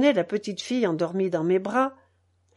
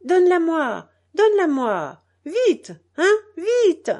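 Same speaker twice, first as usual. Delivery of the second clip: high, louder, urgent call; crying out; 7 semitones higher, 5 dB louder than usual. A narrator's reading. Mother calling to the narrator to hand over her child, crying out. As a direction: call out an impatient order, pitch jumping. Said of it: "me cria en tendant les bras".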